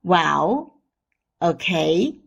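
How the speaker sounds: "Well" and "okay" each dipping, then rising; hesitating tone. Shown as a demonstration. Teacher likens third tone to doubt, hesitating well, okay. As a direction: draw out "Well", "okay" with a reluctant dip and lift. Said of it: "It's hesitating".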